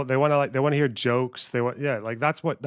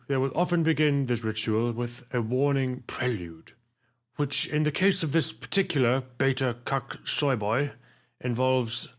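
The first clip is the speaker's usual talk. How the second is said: hushed nature documentary narrator; David Attenborough. Retold or imitated. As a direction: narrate like a wildlife film. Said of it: "My name is David Attenborough".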